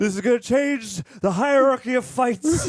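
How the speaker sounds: loud, high, bombastic mock proclamation; Coy impression. Co-host imitating jacked Coy as The Rock, bombastic. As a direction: declare it hugely, like a hyped-up wrestler parody. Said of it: "Yeah, my Coy".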